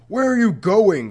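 frantic, high and loud call; sudden panicked shout. A narrator's reading. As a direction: cry out in alarm as he slips away. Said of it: "frantically Mister Hush snatched him".

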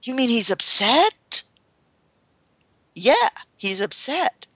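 ordinary delivery

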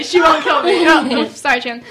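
usual speaking voice

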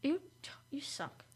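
whispered playful insult. Real, not acted. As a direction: hiss it under your breath.

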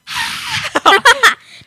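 high delighted laugh. Real, not acted. Laughing after announcement.

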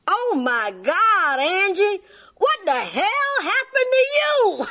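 high, slow Southern drawl rising to a shout; southern twang drawl. Retold or imitated. Opal shocked at Angie's pregnancy bump, Southern drawl shout. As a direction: stretch it out in a broad Southern twang, then yell. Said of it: "in that southern twang drawl".

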